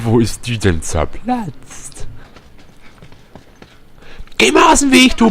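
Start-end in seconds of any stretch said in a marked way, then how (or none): 4.4-5.3 angry shout. Real, not acted. Yelling at character in his way.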